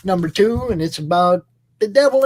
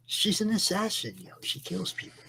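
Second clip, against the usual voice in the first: whispering; 9 dB quieter than usual. Acted. Gleeful whisper about the assassin.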